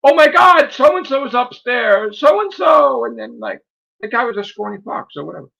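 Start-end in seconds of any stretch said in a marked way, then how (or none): 0.0-3.0 high, loud imitation of alarm; alarmed friend. Imitating a manager friend reporting trouble, mock alarm. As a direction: raise the alarm as the friend, acted out. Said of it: "my friend ... was like".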